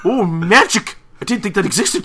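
high, loud put-on amazement; mock astonishment. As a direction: exclaim as if magic were brand new. Acted surprise of spider.

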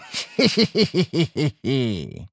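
acted chuckle in a string of short bursts; staccato giggle trailing downward; mocking laugh. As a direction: laugh it off in quick, falling pulses. Laugh during elves' quarrel.

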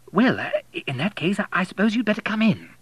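hesitant vicar's wife. A narrator's reading. Vicar's wife flustered into letting William in. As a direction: give way with a flustered stammer.

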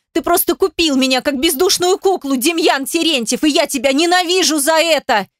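high-pitched tirade, climbing and unrelenting; hysterical screaming. A narrator's reading. Heroine accuses Demyan of buying her, screaming. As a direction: hurl accusations in a high, frantic cry. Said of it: "От крика у меня зашумело в голове".

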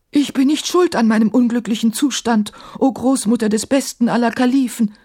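high-pitched, wounded self-defence; hurt, protesting djinn voice; aggrieved djinn. A narrator's reading. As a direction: plead innocence as an offended djinn. Offended djinn defends himself.

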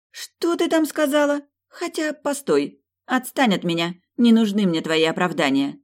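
flounce off in offended huff; indignant princess. A narrator's reading. Melania voiced in the story.

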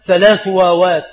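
ordinary talk

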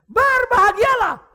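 langzaam, hoog en luid uitgeroepen zegen; slow, high, loud blessing. Acted. Preacher proclaims blessing.

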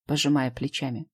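ordinary delivery

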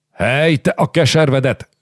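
shout. Acted. Hunyadi shouting to stop fight.

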